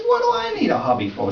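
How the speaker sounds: high, incredulous protest; indignant retort. Retold or imitated. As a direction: throw the question back in disbelief. Husband retorts to wife's nagging.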